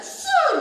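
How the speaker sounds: sudden high whooshing "Shoo"; a quick high swoosh effect; whoosh sound. Retold or imitated. Falling into anger, sound effect.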